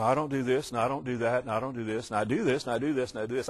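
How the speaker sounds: low, soft rote recital; softly mimicking someone listing do's and don'ts; mock legalist's checklist. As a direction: reel off rules like a smug self-justifier, under the voice.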